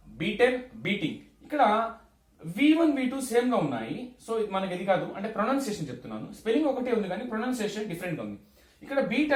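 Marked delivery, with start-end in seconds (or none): none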